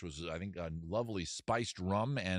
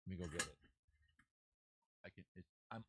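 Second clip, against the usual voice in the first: usual speaking voice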